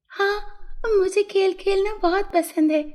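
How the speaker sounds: high fairy voice, keen yes; naughty fairy. A narrator's reading. Fairy tells the boy she loves playing, high keen voice. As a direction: answer high as the eager fairy.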